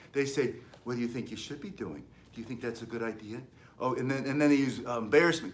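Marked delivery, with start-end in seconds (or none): 0.8-3.4 mock gentle teacher. Mocking teacher's coaxing questions.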